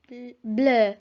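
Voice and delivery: Bleu with rounded French eu; Bl-, bleu, restart then clean French vowel. Shown as a demonstration. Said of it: "Repeat after me".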